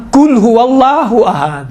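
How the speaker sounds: "قل" with k for q, in slow drawn-out recitation; imitated mispronounced recitation. Teacher quoting the failed contestant's recitation, slow with wrong k. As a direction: imitate a contestant stretching "قل هو اللہ احد" with a kaf. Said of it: "تو اس نے کیا کہا؟".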